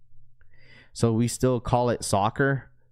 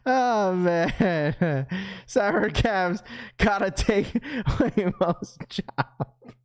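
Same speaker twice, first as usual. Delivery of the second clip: laughing; 9 semitones higher, much slower than usual. Real, not acted. Laughing through words.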